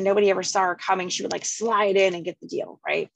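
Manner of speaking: normal talking voice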